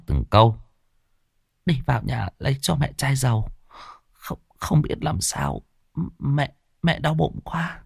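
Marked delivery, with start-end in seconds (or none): 1.7-7.8 weak faint voice. Acted. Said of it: "ôm bụng thều thào, trả lời khó nhọc".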